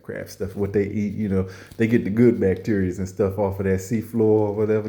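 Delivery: usual speaking voice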